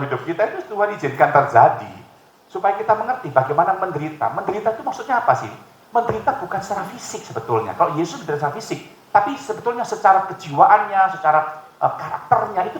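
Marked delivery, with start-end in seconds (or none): none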